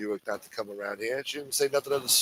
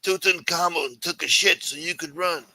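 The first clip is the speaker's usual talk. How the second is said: higher, grandiose disappointed mafioso; Chris Colombo impression; 5 semitones higher than usual. Imitating Chris Colombo guilting Mike about his father and Rome, in a higher voice. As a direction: guilt him with old-world pride. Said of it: "You do a good Chris Colombo?".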